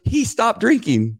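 put-on high, slow young girl's voice. Girl's voice in retold story.

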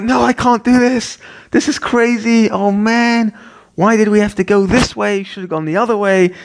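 high-pitched, hammed-up panicked complaint; mock frantic outburst; freaking out. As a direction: retell a meltdown in a high, frantic whine. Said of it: "I'm, like, freaking out".

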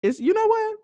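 a high, lightly voiced swoon over African charm; mock swooning. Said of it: "I said".

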